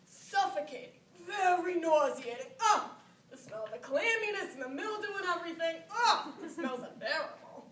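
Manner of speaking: put-on disgusted old woman. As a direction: recoil from a foul smell, drawing words out. Old woman recoils at a smell.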